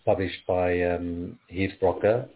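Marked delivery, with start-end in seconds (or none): none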